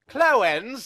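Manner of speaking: high, loud shocked question; astonished. Acted. John repeats the word in high, loud shock. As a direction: blurt it in disbelief.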